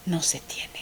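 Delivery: faint unvoiced trailing whisper. Real, not acted. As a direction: let it fade into a whisper. Sad admission about missing support.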